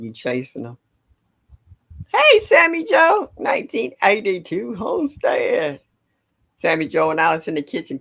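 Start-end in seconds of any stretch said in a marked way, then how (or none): 2.1-5.8 calling out. Real, not acted. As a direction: call her name with delight. Greeting a viewer in chat.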